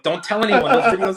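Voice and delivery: embarrassed laughing plea to the gym manager. Retold or imitated.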